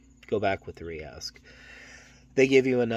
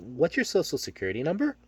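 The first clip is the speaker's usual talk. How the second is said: weird intonation; 7 semitones higher, 4 dB louder than usual. Shown as a demonstration. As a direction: question pitched higher with a rise at the end. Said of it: "intonation changed. I just made it really super weird".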